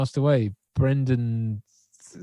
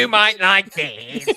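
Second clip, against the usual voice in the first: robot voice; 7 semitones higher, slower than usual. Pretend. Playing along as the robot.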